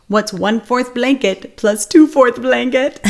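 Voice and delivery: put-on funny storybook camel voice to cheer up a child; Humphrey voice. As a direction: drawl it out like a silly cartoon camel. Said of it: "we'd say".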